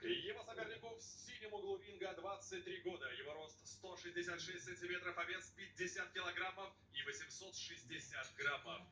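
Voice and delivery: slow, drawn-out ring introduction; boxing ring announcer. Real, not acted. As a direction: announce the fighter to the arena. (объявление ринг-анонсера, растянуто)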